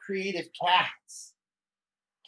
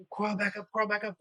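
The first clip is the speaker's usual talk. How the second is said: hushed encouragement, much softer; soft urging; 9 dB quieter, faster than usual. Acted. Teacher quietly urging the little spider, soft coaxing. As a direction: coax it back up softly.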